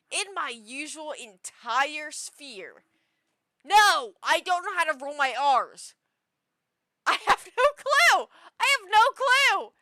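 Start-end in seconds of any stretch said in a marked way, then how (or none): 3.6-5.9 loud, exasperated no; loud frustrated admission; exasperated shout. Real, not acted. Exasperated about rolling R's. 7.1-9.7 high, loud laughing cry of defeat; shrieking with laughter, no clue; laughing shout. Real, not acted. Laughing exasperation.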